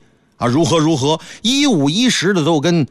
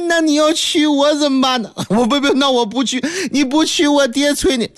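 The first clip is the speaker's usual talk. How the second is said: higher, playful whining wife; wife teasing; 7 semitones higher than usual. Acted. Wife traps husband in circular logic, whiny. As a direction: whine in circles.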